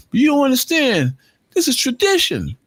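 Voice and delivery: high-pitched imitation of the hallway guys; mock young guys. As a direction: squeak it out like the kids on the steps. Young men defending hallway hangout.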